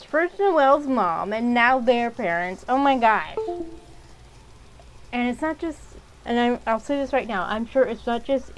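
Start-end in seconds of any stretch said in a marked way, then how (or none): 2.7-3.4 louder, punchy exasperation; loud quick oh my gosh; annoyed. Real, not acted. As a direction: snap it out, fed up. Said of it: "These parents".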